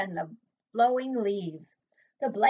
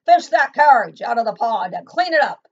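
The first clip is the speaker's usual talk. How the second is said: much louder command; booming order; 2 semitones higher, 13 dB louder than usual. Acted. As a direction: boom it down from the tree. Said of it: "she told them".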